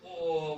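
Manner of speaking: ओ held for a very long time; prolonged vowel. Shown as a demonstration. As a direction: stretch ओ out as a pluta vowel. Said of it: "ह्रस्व स्वर और दीर्घ स्वर से भी अधिक समय".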